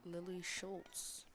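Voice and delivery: normal talking voice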